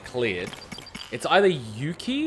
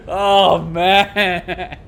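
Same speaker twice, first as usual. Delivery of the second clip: usual speaking voice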